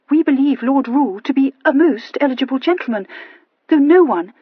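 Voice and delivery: prim, gently reproving tone; a hint of a disapproving aunt. A narrator's reading. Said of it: "a reproving note in her voice".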